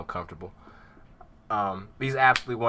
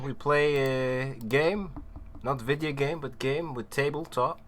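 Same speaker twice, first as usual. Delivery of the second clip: higher, slow mock accent of no real country; made-up foreign accent; 4 semitones higher than usual. DM welcoming players in a terrible fake accent. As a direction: ham up a nonsense foreign accent for the intro. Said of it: "That is a terrible accent".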